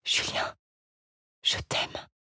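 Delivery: breathless whisper of love. A narrator's reading. Jeanne whispering her love to Julien, hushed. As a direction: whisper his name tenderly, no voice at all. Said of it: "Elle murmura tout bas".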